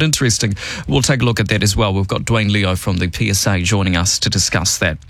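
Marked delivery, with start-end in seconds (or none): none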